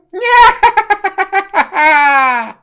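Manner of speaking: high, loud long maniacal laugh; maniacal Joker laugh. Acted. Joker triumphant after gloating, loud maniacal laugh. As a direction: laugh like a mad genius.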